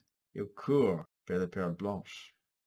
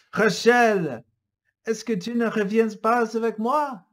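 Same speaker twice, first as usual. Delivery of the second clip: pleading; 11 semitones higher, 9 dB louder than usual. Acted. Antoine pleading with Rachel.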